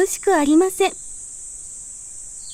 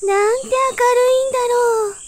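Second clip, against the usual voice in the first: high amazed little voice; dazzled wonder; 9 semitones higher than usual. A narrator's reading. New bud popping out into sunlight, high amazed voice. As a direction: gasp at the brightness, voiced much higher. Bud sees light first time.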